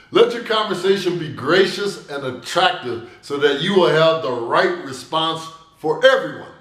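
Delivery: normal talking voice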